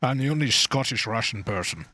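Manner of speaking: lightly imitated Scottish Sean Connery; a hint of a Scottish accent playing Russian; Sean Connery impression. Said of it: "Sean Connery's just like".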